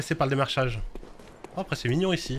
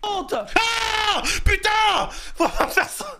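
shouting; much slower than usual. Real, not acted. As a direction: shout in frustration. Shouting at game.

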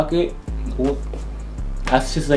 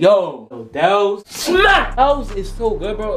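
high, drawn-out excitement; excited; 6 semitones higher, much slower than usual. Real, not acted. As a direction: rave about it, stretching words. Raving about favorite lemonade spot.

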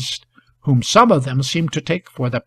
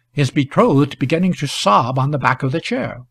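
ordinary delivery

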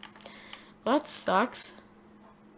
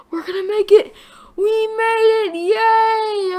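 relieved cheer; 10 semitones higher, 6 dB louder, slower than usual. Real, not acted. Escaping storm, relieved cheer.

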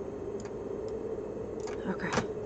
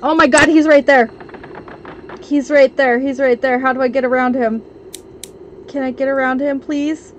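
loud, higher-pitched alarm; panicked; 4 semitones higher, 4 dB louder than usual. Real, not acted. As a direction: cry out in real panic as the threat appears. Spots monster blocking the way.

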